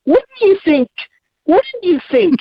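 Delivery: high-pitched disbelief at the obvious; incredulous. Real, not acted. Caller scoffing at hydration advice, incredulous. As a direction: pitch leaps up in exasperation.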